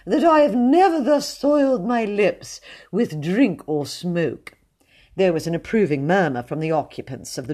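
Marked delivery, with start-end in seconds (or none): 0.0-4.5 sanctimonious. A narrator's reading. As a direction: savour every word of the boast. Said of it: "he said sanctimoniously".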